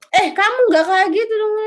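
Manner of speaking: high, loud mock-angry scolding; angeblich wütend. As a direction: scold someone as if getting angry too. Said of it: "ikutan marah juga ya".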